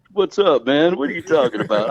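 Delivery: ordinary delivery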